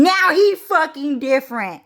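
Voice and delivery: high, loud mock European accent; badly faked British or French accent; attempted European accent. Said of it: "I don't really do British accent or, like, French accent".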